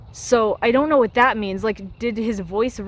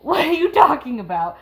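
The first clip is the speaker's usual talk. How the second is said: higher-pitched incredulous question; 6 semitones higher, 4 dB louder than usual. Real, not acted. Fan reacting to the gift from Aslan line, incredulous. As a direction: throw the question out in disbelief.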